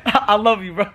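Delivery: high voice breaking into laughter; giggly, gushing confession; laughing apology. Real, not acted. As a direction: apologize to a pranked friend while cracking up. Laughing apology as prank cracks.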